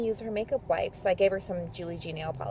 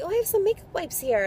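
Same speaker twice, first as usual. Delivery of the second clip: put-on bright helpful girl; 10 semitones higher, faster than usual. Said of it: "And she's like".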